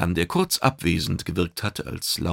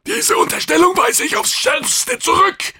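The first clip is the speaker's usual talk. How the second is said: very high, loud, outraged creature voice; indignant alien; 8 dB louder than usual. A narrator's reading. Kidang rejecting the bird's accusation, high angry shout. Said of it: "entgegnete El Bantili mit erbost sirrenden Geweidzangen".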